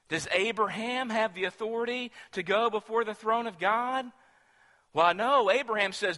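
ordinary talk

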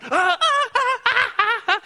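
high, loud yelps of pain, mocking a bully; repeated shrieking "ah"s, hammed up; girlish scream. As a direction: imitate a bully screaming like a little girl. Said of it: "He's screaming like a little girl".

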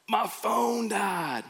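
slow, stunned panic over my phone; mock panic. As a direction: let it sink in with dread, slowly. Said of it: "and then a panic of".

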